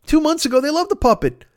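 high-pitched heated rant; worked-up exasperated tirade; rising rant. Real, not acted. Escalating rant about outraged fans.

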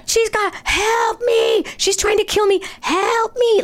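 very high, drawn-out cries for help; imitated scream. Resident showing how neighbours screamed for help, mock screaming. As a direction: mimic a screaming neighbour, stretching each word. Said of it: "Like, screaming at the top of their lungs".

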